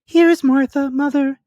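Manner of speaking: put-on a little girl bursting with news; excited girl. A narrator's reading. A girl tells her mother Martha has arrived, high and excited. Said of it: "said a girl".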